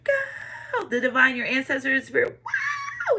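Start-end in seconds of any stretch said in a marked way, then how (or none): 0.0-0.9 mock cheering. Friends urging you off the diving board, mock cheer. 2.4-3.2 very high, held-back squeal; mock cheering. Ancestors cheering you at the diving board, high mock cheer.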